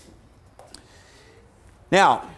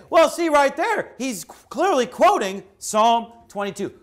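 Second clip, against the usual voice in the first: high-pitched imitation of a confident objector; mimicking an objector; 7 semitones higher than usual. Preacher voicing the listener who objects, mimicking. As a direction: jump up high to voice the other side. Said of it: "And you say".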